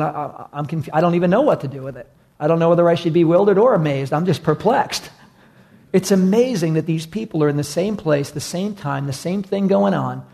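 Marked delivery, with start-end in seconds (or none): 0.0-5.1 fast, flustered confusion; perplexed. Retold or imitated. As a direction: tumble over the words in confusion. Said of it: "others were perplexed, going".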